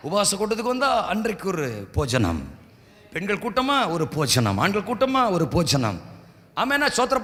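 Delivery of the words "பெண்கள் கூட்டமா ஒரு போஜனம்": ordinary talk, nothing marked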